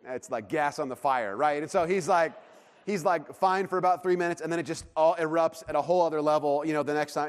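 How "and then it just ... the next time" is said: normal talking voice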